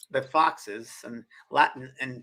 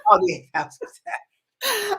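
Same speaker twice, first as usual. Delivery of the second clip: much higher, slow laughing echo; 3 dB louder, slower than usual. Real, not acted. Astrologer echoing the client's joke, laughing. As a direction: repeat it drawn out through laughter.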